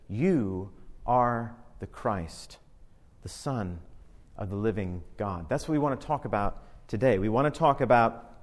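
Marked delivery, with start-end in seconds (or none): 0.1-5.5 slow, soft and hushed, each phrase drawn out; reverent quiet confession. Retold or imitated. Peter's confession quoted slowly.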